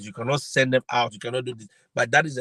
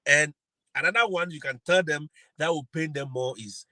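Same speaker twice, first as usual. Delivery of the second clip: usual speaking voice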